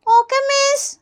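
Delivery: high schoolkid voice; child pupil. Acted. Pupil obeying the nurse, high child voice. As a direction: obedient little-kid reply.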